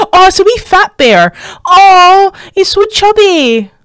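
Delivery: high, loud gushing coo; squealy, besotted delight; adoring coo. Real, not acted. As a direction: coo over an adorable animal, drawing out the end. Cooing at a fat bear.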